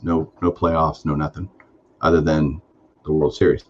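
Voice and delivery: ordinary delivery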